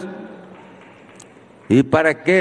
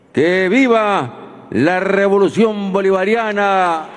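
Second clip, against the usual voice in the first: slow drawn-out rally slogans; ranting dictator speech; 4 semitones higher, slower than usual. Real, not acted. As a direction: call out each slogan to the crowd. Rally closing, shouted slogans. (consignas gritadas lentamente)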